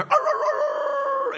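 very high, drawn-out howl; dog howl. Acted. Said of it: "dog's a sensitive ears and you hear".

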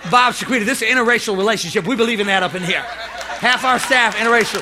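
loud, high, driving sermon; full-force preaching. Real, not acted.

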